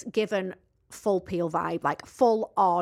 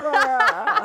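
mock posh Chelsea exclamation; posh Chelsea "rah"; 8 semitones higher, 7 dB louder than usual. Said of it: "they start talking all, like, made in Chelsea".

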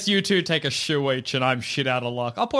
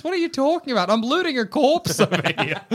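indignant; 8 semitones higher than usual. Acted. Indignant reply while looting.

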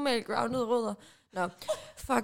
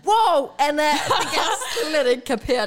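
loud, higher burst of disbelief with a laugh; shocked shout; 4 semitones higher, 8 dB louder, slower than usual. Real, not acted. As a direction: shout out your surprise, laughing. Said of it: "Undskyld, jeg råbte".

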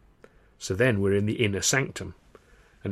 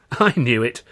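triumphant; 3 semitones higher, 9 dB louder than usual. Acted. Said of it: "Charlie says".

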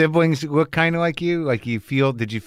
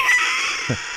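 short high-pitched laugh; amused laughter. Real, not acted. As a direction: crack up briefly. Laughs at one-off joke.

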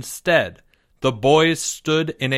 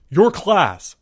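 higher, sharp retort; snapped; 6 semitones higher, 4 dB louder than usual. A narrator's reading. Mister M to Mister L about his class, snapped. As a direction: snap it back accusingly. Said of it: "snapped Mister M".

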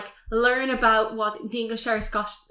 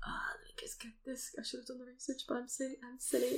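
hushed, whispery self-talk; muttering to self; 19 dB quieter, faster than usual. Real, not acted. Host muttering to self while looking up an actor's name, hushed. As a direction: mumble under your breath, far softer.

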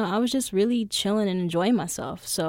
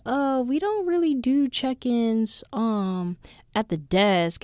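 higher, drawn-out hesitant clerk; imitated desk clerk; 4 semitones higher, slower than usual. Desk guy turning down a bag check, hesitant imitation. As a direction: mimic a reluctant airline employee, slowly. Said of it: "He was like".

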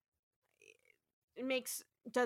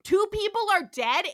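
higher, louder emphatic protest; Chapel; 5 semitones higher, 7 dB louder than usual. Retold or imitated. Nurse repeats her objection to Andrea, exasperated raised voice. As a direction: raise the voice and hammer each word. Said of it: "Chapel's like".

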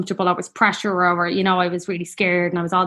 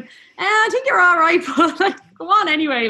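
laughing mock reply; 11 semitones higher, 3 dB louder than usual. Joking to the midwife about squeamish Chris, laughing and much higher. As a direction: tease the midwife through a laugh.

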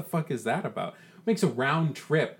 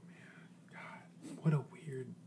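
unvoiced whisper; 15 dB quieter, slower than usual. [name] whispers about the weird drive. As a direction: hush it to yourself. Player whispering on night bus.

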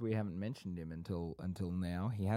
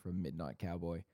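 ordinary talk

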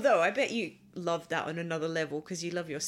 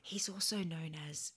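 whisper; 8 dB quieter than usual. Pretend. Continuing the secret whisper.

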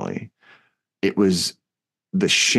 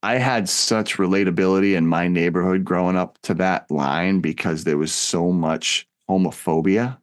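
ordinary talk, nothing marked

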